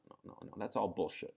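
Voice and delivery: ordinary delivery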